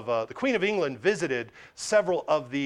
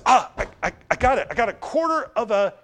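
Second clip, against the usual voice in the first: excited, higher-pitched discovery; eager and louder, tripping over the first words; sudden realization; 5 semitones higher, 4 dB louder than usual. Acted. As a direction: blurt it out as the idea hits you, stammering a little. Servant suddenly finds money for offering.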